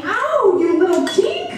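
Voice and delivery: high-pitched acted yelp of pain; pained outburst. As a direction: scold through a sudden pain, pitch jumping up. Mother hit in the knee.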